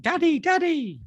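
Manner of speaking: high, mild mock scream; imitated child screaming. Imitating his son screaming for Daddy, alarmed cry. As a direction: call out like a frightened little boy. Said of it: "the next time he screamed".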